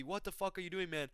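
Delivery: ordinary talk, nothing marked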